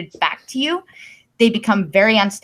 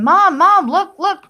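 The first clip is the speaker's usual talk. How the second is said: put-on higher, louder child calling for mom; 9 semitones higher, 5 dB louder, slower than usual. Toddler begging the silent mom to look, higher and louder. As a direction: imitate a kid pleading for attention.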